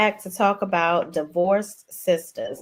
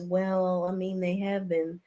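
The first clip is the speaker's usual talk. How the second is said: softer, hesitant imitation; Tiffany quoting; 7 dB quieter than usual. Retelling Tiffany's hesitant answer, softer mock voice. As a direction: mimic a reluctant admission. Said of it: "Tiffany chimes in and says".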